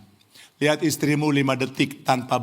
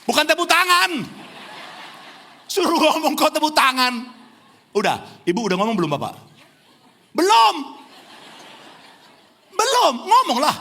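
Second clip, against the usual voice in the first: excited calling out; 12 semitones higher than usual. Real, not acted. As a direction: call out playfully over the room. Preacher calling out to congregation.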